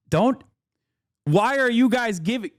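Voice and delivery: high, pleading cry of don't to chat; pleading protest. Real, not acted. Streamer pleading with chat not to encourage Demi, in a high voice. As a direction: beg them to stop, half laughing.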